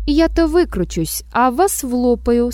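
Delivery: ordinary delivery, nothing marked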